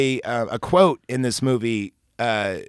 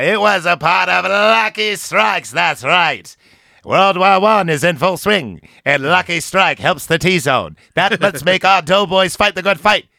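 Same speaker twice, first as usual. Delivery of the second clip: higher, louder vintage radio pitchman; old-timey ad announcer; 5 semitones higher, 3 dB louder than usual. Pretend. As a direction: sell it like a 1950s cigarette ad. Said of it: "Can you do the voice?".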